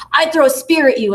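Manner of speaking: mock challenge from a gleeful kid; imitating excited student. Students acted playing their spear card.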